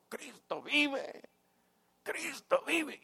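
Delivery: high ringing cry of faith; retold joyful shout. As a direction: call it out high from the back. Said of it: "alguien gritó". (grito alto y agudo desde atrás)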